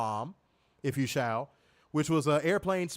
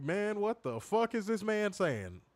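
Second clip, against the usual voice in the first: incredulous confusion; 6 semitones higher, slower than usual. Retold or imitated. Retelling confusion at promo.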